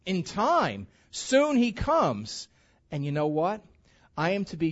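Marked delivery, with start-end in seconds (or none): 1.1-2.5 high and very slow, each word weighted; slow emphatic. Real, not acted. Pastor stressing the Lord's coming to the congregation, slow and weighty. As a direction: let each word land slowly. Climax of scripture point.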